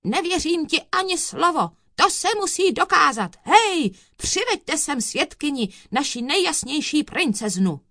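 high, forceful dismissal and summons; thundering judge. A narrator's reading. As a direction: call out orders across the courtroom. Judge keeps thundering, calls for witness.

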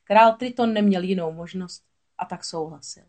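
usual speaking voice

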